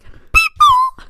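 shrieking high crack on the word People. Retold or imitated. Singer reenacts her freshman voice cracking, shrieking. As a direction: squeal People as the voice flips up loud. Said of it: "my voice went, like".

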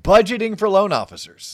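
Budgeting for loan officers, loud and bright; excited loud announcement. Pretend. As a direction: punch it out with energy. Host restates the word.

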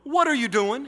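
angry mother scolding her son. Retold or imitated. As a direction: snap at the boy like a mother catching him. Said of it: "She angrily called him over".

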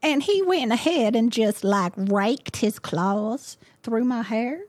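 put-on storytelling in a broad Southern accent; Southern belle. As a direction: play a wounded Southern belle. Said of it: "Southern Dan Bell's just fine".

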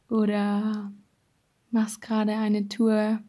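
calm them down and guide inward; guided meditation. Acted. (ruhige geführte Meditation)

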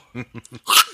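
wheezy villain's cackle. Pretend. The friend mocks the laugh, a wheezing villain cackle. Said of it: "laughing like fucking Snidely Whiplash".

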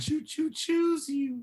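put-on high, slow shy kid voice; Ralph Wiggum voice. S0 quoting Ralph's Valentine to Lisa, high slow kid voice. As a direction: say it like a bashful little boy. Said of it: "the Valentine".

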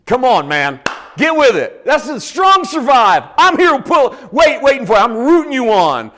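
loud, high coach yelling encouragement; hammed-up rally cry from the sidelines; God as a shouting cheerleader; mock cheering shout. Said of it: "What that would sound like is that God goes".

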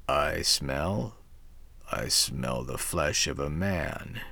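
low, slow, menacing devil voice; sniffing devil. A narrator's reading. The devil sniffs out a man, low and slow. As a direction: sniff the air like a lurking devil. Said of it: "he said".

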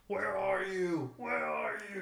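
drawn-out mock shout, high then dropping; hammed-up searching cry; movie-scene quote. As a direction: call out like a spooked movie thug.

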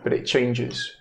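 hushed scene-setting with long pauses; measured, tender narration; game master narration. A narrator's reading. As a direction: let each phrase hang, storyteller style.

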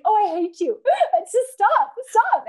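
high, loud, laughing mock protest. Wife to teasing husband, laughing and begging him to stop. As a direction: squeal it through giggles, pleading. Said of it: "And I'm like".

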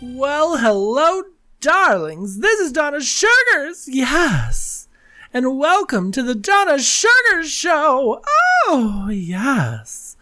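high, sassy drag diva voice; campy drag queen. Pretend. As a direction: camp it up like a showgirl host. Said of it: "this voice".

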